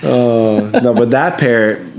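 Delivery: ordinary talk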